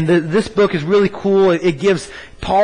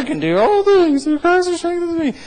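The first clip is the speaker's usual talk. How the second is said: much higher, silly squeak of a voice; mock squeaky verse; 12 semitones higher than usual. Shown as a demonstration. Pastor mocking the crowd's smart-aleck take, high and silly. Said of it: "Say it like I mean it".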